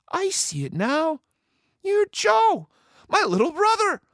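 very high-pitched woman pirate; high, astonished Stinky Annie; amazed recognition. A narrator's reading. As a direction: realize it with growing wonder. Annie recognizes her brother.

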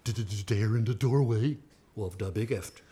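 low, slow, stammered start; mock fright. A narrator's reading. Storyteller revealing the scary bear, slow low mock-fearful telling. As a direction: drop low in hushed dread.

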